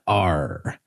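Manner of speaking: low, soft stock pirate "Arr."; pirate growl. Shown as a demonstration. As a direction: drop into a cartoon pirate grunt. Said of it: "Pirates said".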